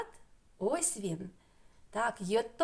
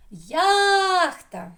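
ЯХТА with a long, high, loud ЯХ; stress on first syllable; 9 semitones higher, 10 dB louder than usual. Shown as a demonstration. Teacher finds where stress falls, ЯХТА with ЯХ stretched. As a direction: call out ЯХТА, leaning hard on ЯХ. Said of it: "Куди наголос буде ставити?".

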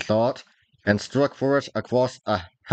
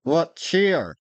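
a lifted, higher cry of greeting; 6 semitones higher than usual. Acted. Mild cry, a touch higher. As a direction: Dick rallies the sobbing boy with a mild cry. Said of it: "cried Dick".

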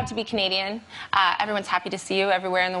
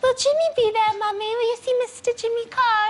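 put-on high, eager little girl's voice; little daughter. Daughter asking mum about Jimmy Carr, high and eager. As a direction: ask it like an excited five-year-old. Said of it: "She goes".

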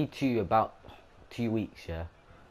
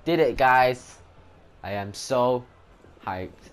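excited; 4 semitones higher, 10 dB louder, slower than usual. Real, not acted. Celebrating the kill.

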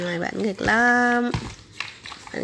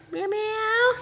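much higher sing-song "Meo meo" with a rising end; mother showing baby how to say "Meo meo"; baby-talk meow; 11 semitones higher than usual. Shown as a demonstration. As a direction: model the word for the baby, way up high. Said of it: "con gọi meo meo đi".

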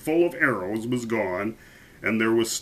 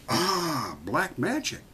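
high, softer dawning voice; king's realization; 6 semitones higher, 4 dB quieter, slower than usual. A narrator's reading. King realizing what the hat is, dawning. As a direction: let the idea dawn aloud. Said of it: "said the king".

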